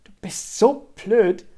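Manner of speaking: scolding. A narrator's reading. Tuna snaps back at Scarlett's teasing, scolding her. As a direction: hurl the insult slowly and loudly. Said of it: "schimpfte Tuna".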